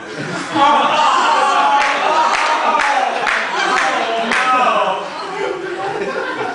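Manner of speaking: long high wheezing laugh that rises; helpless loud laugh; breathless laugh. Real, not acted. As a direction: crack up completely. Laughs at the apology.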